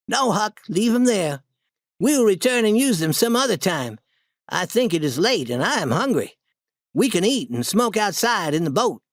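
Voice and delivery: lightly voiced boy, settling the plan; bossy Tom. A narrator's reading.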